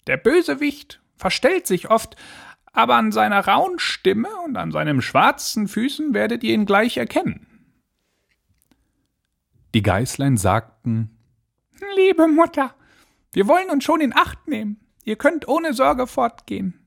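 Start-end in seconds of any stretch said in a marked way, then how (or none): 0.1-7.5 very high-pitched mother goat voice; high, put-on nanny goat warning her little ones. A narrator's reading. Mother goat warns her children about the wolf, high put-on voice. 12.0-16.8 very high, put-on kid voice; squeaky-high little goats answering mum. A narrator's reading. Young goats reassure their mother, high childlike voice.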